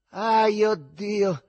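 loud, high cry of exhaustion; exhausted groan. A narrator's reading. Rider flopping down on the tram rail, worn-out wail. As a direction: wail it as you collapse.

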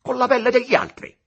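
high, loud accusing question; outraged exclamation. A narrator's reading. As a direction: fling the accusation at him. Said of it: "esclamò Aurelio Costa". (domanda indignata ad alta voce)

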